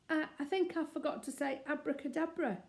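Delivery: nervous little bear owning up; faltering. Acted. Paddington admitting his mistake to angry Mister Curry, faltering. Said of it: "faltered Paddington".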